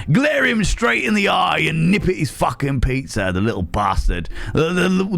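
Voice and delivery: scheme aloud as a brash Cockney seagull. Acted. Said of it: "all seagulls are Cockney".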